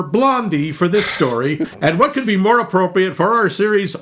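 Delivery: normal talking voice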